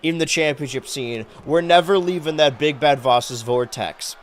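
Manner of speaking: ordinary talk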